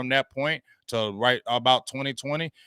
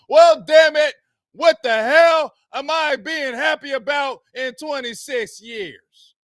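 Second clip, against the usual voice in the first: high, loud exasperated outburst; ranting outburst; 12 semitones higher, 8 dB louder, slower than usual. Real, not acted. Host ranting at the fans about the Cowboys, angry shout. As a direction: blow up in frustration. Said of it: "I'm just ranting".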